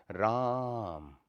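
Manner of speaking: "राम" with a very long held "आ", falling at the end; extra-long vowel. Shown as a demonstration. Calling someone in a play's dialogue, extra-long vowel. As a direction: stretch "राम" like calling out a name. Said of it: "दीर्घ से भी ज़्यादा समय लगता है".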